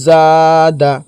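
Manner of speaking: জা held long, দা cut short; long first syllable. Shown as a demonstration. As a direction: draw out জা in জাদা, then a brief দা. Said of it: "এই কথাতে টান হবে".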